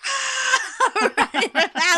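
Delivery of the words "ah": mock shriek of fright; high scream. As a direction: shriek like a scared kid. Kid screams and runs.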